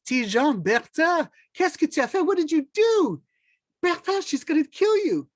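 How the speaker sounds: high, agitated warning; alarmed neighbor. Acted. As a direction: throw up your hands at what he did. Neighbor Jules alarmed at the dung.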